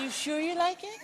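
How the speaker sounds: Michael teasing his friend softly. Retold or imitated. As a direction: tease in a gentle high voice. Voices Michael.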